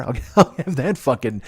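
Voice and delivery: cracking himself up mid-rant; laughing. Real, not acted. Telling Jill about the joint, laughing.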